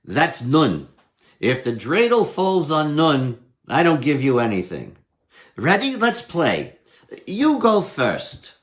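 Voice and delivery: lightly voiced Herschel. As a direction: explain the rules like a crafty trickster. Herschel finishes rules, starts game.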